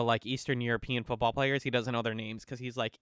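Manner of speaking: ordinary delivery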